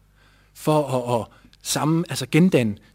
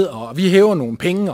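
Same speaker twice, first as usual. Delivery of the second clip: ordinary talk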